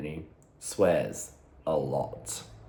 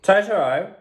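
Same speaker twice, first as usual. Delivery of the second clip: calling out; 12 semitones higher, 10 dB louder than usual. Real, not acted. Calling the dog.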